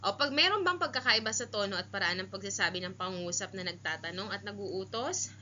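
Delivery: normal talking voice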